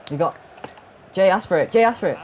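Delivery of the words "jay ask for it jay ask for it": loud, raised call to a teammate; urgent high call. Real, not acted. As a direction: shout the instruction across the game. Calling to teammate in play.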